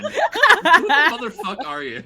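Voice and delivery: high, loud comic outrage; exasperated shout. Real, not acted. Demanding whose side a friend is on, exasperated shout. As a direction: yell accusingly through the teasing. Teased, challenges friend while group laughs.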